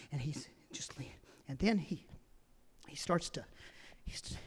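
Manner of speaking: breathy whisper. Real, not acted. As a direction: drop to a whisper. Hushed suspense narration.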